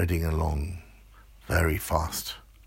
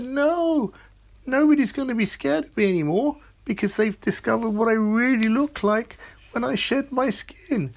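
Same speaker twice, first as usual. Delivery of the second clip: much higher, squeaky little voice; tiny little man; 5 dB louder than usual. Acted. Tiny man bemoaning his lost skin to Amelia, high and squeaky. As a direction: plead like a four-inch man.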